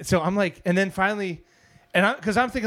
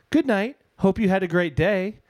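higher, chirpy assistant voice; 5 semitones higher than usual. Retold or imitated. Alexa answering a woman's goodnight, voice assistant. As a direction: chime it like a polite device. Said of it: "I hear this other voice go".

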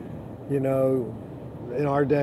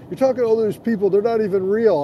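quick, higher wife quote; quoting his wife; 6 semitones higher, 5 dB louder, faster than usual. Retold or imitated. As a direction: rattle it off like the skeptical wife. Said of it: "'cause she said".

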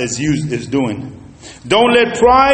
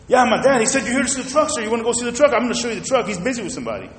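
eager salesman; 6 semitones higher, much faster than usual. Retold or imitated. Salesman eagerly addressing other customer.

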